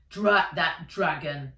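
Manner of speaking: grumbling granddad. A narrator's reading. Granddad cursing the dragon robot, gruff grumble. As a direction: curse it like a cranky grandfather.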